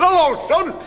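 father's greeting pitched high and loud, not soft at all; a hammed-up dad calling out; soft northern voice. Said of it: "that soft northern voice".

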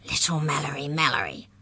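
menacing whispery hiss. A narrator's reading. Maury whirls upon Mallory, hissing angrily. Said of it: "he hissed".

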